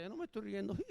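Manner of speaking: high, soft denial breaking into a giggle; mock denial with a tiny squeaky laugh; stifled laughter. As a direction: imitate a woman giggling while denying it. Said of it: "tu mujer se está riendo de nosotros".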